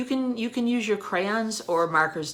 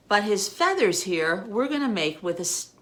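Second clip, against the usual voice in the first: usual speaking voice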